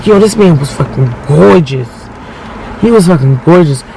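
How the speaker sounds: ordinary delivery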